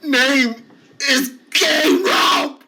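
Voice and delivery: a put-upon patient protesting his name; whiny protest. Acted. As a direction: wail the denial like a wounded man-child. K Rob character insists.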